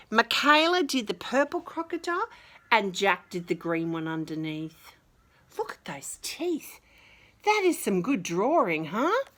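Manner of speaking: ordinary delivery, nothing marked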